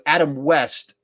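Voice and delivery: ordinary delivery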